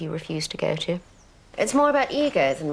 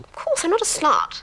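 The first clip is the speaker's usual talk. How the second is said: high, loud indignation; mock offence; 9 semitones higher, 5 dB louder than usual. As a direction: play hurt, huffy and sharp.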